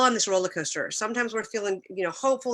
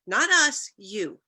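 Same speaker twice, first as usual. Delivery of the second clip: raised-voice correction, imitating a teenager; pointed teen objection; 5 dB louder, much slower than usual. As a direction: imitate a daughter setting mom straight, pitched up and loud. Teen daughter objects to mom.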